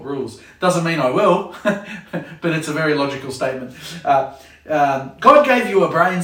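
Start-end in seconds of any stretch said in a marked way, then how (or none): 5.2-6.2 louder, higher rebuke; a fed-up parent's sharp rebuke; scolding parent. Acted. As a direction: scold a thoughtless kid.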